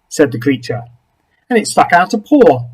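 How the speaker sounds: ordinary delivery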